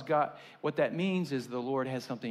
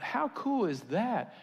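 delighted exclaim; 8 semitones higher than usual. Retold or imitated. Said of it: "And I thought".

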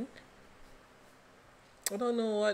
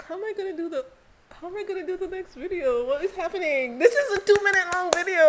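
much higher, louder flustered exclamations; excited; 11 semitones higher, 11 dB louder, faster than usual. Real, not acted. Overwhelmed by the video, high excited exclamations.

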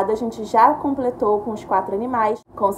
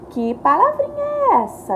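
much higher sing-song question; high teacher question; 13 semitones higher than usual. Shown as a demonstration. Teacher quizzing pupils on a word. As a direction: ask the kids brightly. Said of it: "question to children".

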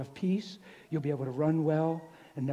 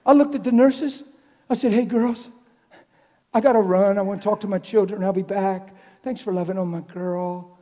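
loud, high, rousing delivery; exuberant preaching; 6 semitones higher, 13 dB louder than usual. Retold or imitated. Preacher retells leaving his wife with the nurses, loudly exuberant. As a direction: call out brightly at full volume.

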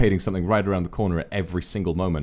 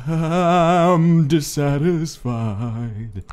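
slow, louder mock complaint; drawn-out mock complaint; 4 semitones higher, 7 dB louder, much slower than usual. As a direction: stretch it out, mock annoyed. Mock reply to game.

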